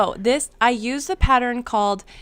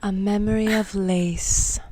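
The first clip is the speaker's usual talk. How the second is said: dramatic announcement; 3 dB louder than usual. Pretend. Dramatic title reveal.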